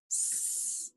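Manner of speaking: S as a long soft hiss, "sss"; drawn-out letter sound. Shown as a demonstration. Teacher sounding out letters for spelling, S as a soft hiss. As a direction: sustain the sound of S, not its name. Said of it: "think about those sounds".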